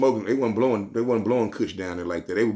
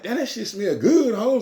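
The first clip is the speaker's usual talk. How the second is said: lightly raised, slowed clerk voice; voicing the clerk; 12 semitones higher than usual. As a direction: play the clerk, higher and drawn out. Store clerk voiced in story.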